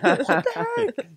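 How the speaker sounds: high, drawn-out, laughing retort; laughing disbelief. Retold or imitated. Retelling a baffling sandwich order to friends, laughing disbelief. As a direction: squeal it slowly through laughter. Laughing through retold reaction.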